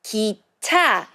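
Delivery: teacher modeling 기차; model for repeating. Shown as a demonstration. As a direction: say 기차 for the class to copy. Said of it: "Repeat after me".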